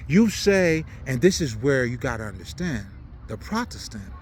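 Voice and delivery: ordinary talk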